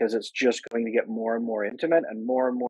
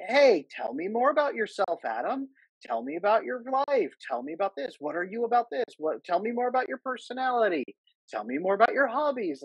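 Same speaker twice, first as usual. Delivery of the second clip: imitating a pushy fan; 7 semitones higher than usual. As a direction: play the fan fishing for personal details, sweetly. Imitates women asking personal questions.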